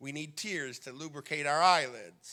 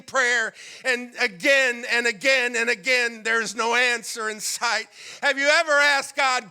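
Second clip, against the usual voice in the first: impassioned preaching; 6 semitones higher, 9 dB louder than usual. Acted. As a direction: cry out to God in the sermon. Impassioned preaching, voiced plea.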